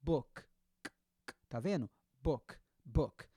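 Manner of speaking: Book cut off sharply on the K; dry final K. Shown as a demonstration. Teacher repeats Book with a dry K for students. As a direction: say Book with no vowel after the K. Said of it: "Termina com K seco".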